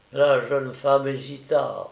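ordinary delivery, nothing marked

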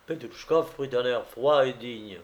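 usual speaking voice

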